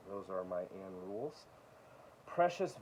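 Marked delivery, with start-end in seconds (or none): 0.1-1.5 much softer echo of Those are my Ann Rules; a soft, satisfied afterthought; soft repeat. Real, not acted. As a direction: say it again quietly to yourself.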